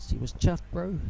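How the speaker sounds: put-on thick New Zealand accent; Kiwi accent. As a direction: drop into a broad Kiwi accent. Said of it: "thick, thick Kiwi accent".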